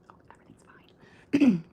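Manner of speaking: slow, voiceless mock whisper; slow hushed "nothing to see here"; whispered denial. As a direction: whisper it slowly, pretending all is fine. Embarrassed aside, covering up.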